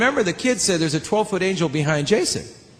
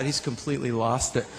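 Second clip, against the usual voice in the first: softer, lower worried aside; hushed thought; 6 semitones lower, 8 dB quieter than usual. Retold or imitated. Watching Jason on stage, softer and lower. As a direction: say it under your breath. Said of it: "I thought".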